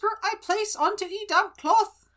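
very high rustic character voice; [name]. Acted. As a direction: pipe up high as a comic stage diver. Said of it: "[name], are you ready".

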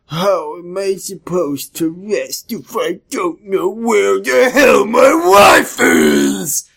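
drawn-out demand building to high and loud; hammed-up slow outburst, climbing to the end; exaggerated attitude. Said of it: "getting a little bit of an attitude".

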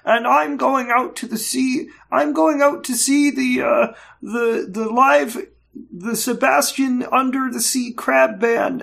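high, dreamy parody monologue; lightly hammed-up starry-eyed speech; mock earnest hero. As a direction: gush it like a naive anime hero.

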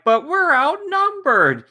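high, slow fretful voice; nervous Autobot. Retold or imitated. Podcaster mimics a scared Autobot fretting before a fight, high and slow.

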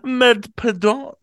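high, slow mock flustered apology; hammed-up flustered high apology. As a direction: ham up a flustered Oh oh. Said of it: "No, I'm kidding".

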